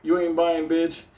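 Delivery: high, laughing, taunting voice; laughing mock taunt from an app page that blocks your sale; mock app message. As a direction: sneer it like a smug trading app. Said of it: "fucking pages that say".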